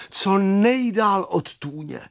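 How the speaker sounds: usual speaking voice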